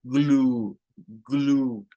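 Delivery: "Glue" with a drawn-out oo; "glue" ending on a long, pure oo; long U sound. Shown as a demonstration. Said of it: "va con el sonido largo".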